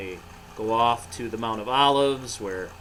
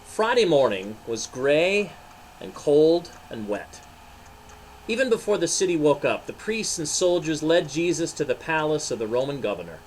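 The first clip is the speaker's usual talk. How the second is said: story narration; 4 semitones higher, 4 dB louder than usual. A narrator's reading. Pastor reading the Easter story to children, storytelling. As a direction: read it like a picture book.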